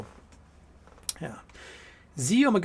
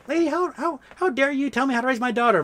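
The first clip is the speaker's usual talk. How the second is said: high-pitched outraged lady; mock-offended mother, pitched way up; indignant lady; 10 semitones higher than usual. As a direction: squeal it in shocked indignation. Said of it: "the lady's like".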